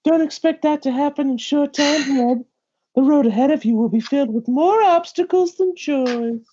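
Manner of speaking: lightly voiced wise elder; stern old mentor. Mentor cautions young Red about the road, lightly voiced elder.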